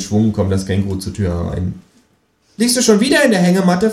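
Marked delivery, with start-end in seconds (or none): 2.6-3.9 high, cheeky kangaroo voice. Acted. Reading aloud, the narrator voices the Kangaroo asking him to pay, high and cheeky. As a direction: voice the Kangaroo a notch higher.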